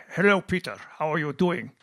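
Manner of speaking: put-on German-accented hallway greeting; thick German accent. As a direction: imitate a colleague's heavy German accent. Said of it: "in his very thick German accent".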